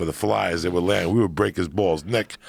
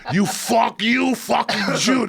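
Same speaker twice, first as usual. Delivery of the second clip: high, slow mock yell of the pizza man; imitated yell; 4 dB louder, slower than usual. Pizza man yelling at the cheap kid across the street, high slow mock yell. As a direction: stretch it out, hammed-up shouting at the kid. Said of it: "he'd yell at the kid".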